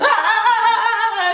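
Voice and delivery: high, loud hammed-up wail; mock wail. A narrator's reading. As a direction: bawl like a storybook princess. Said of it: "began to wail".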